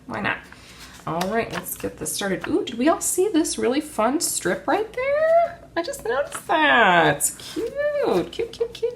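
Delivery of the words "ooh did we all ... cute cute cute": high-pitched gushing over something cute; squealing with delight. Real, not acted. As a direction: coo it like you found a treasure.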